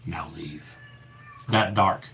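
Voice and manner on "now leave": very soft, low command; low whispered command. Retold or imitated. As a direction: drop it to a dark hush. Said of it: "the voice goes".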